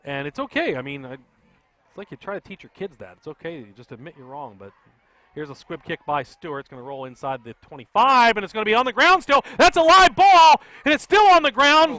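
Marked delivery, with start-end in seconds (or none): 5.3-12.0 rising excited call, much higher and louder; excited play-by-play. Real, not acted. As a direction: call the loose ball live. Live play-by-play of loose ball.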